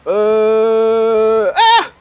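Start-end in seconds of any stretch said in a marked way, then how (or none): 1.5-1.9 much higher sudden yelp; startled squeal. Real, not acted. Gamer squealing in surprise at a sudden play, higher.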